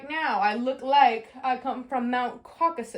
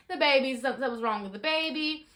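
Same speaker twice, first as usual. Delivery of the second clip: ordinary delivery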